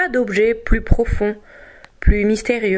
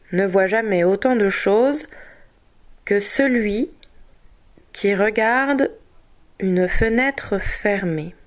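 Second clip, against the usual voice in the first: dictation in pieces. Shown as a demonstration. Teacher reading a text for dictation, in separated chunks. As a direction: read piece by piece with long pauses. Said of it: "par morceaux sous la dictée".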